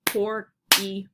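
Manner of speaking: "porcupine" as pork, EE, pine; variant pronunciation. Shown as a demonstration. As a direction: separate syllables, "ee" in the middle of "porcupine". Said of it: "and some say".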